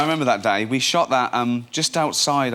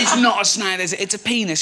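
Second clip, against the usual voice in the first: high, loud mock-furious teacher; the teacher loses it, a higher, louder voice; 7 semitones higher, 4 dB louder than usual. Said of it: "And he went".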